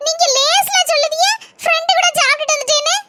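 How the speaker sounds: angry wife. Acted. Wife whose husband ate out after she cooked, angry complaint. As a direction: build to a high, accusing question. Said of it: "ஏன் இப்படி கோவப்பட்டுட்டு இருக்க?".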